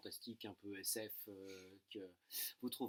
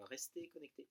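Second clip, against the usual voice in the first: ton mystérieux; 10 dB quieter than usual. Real, not acted. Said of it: "L'art du teasing".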